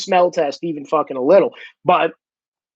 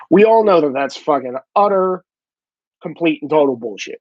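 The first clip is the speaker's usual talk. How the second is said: ordinary delivery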